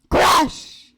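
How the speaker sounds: big loud burst; shouted crash. Acted. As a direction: shout it like an impact. Narrating car crash.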